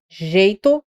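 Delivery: ordinary delivery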